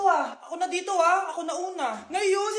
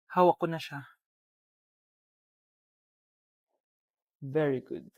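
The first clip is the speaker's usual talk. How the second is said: much lower, distant hushed gloat; creeping evil satisfaction; sinister ghost; 13 semitones lower, 4 dB quieter than usual. Acted. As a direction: savor the capture quietly. The spirit gloats over the captured girl.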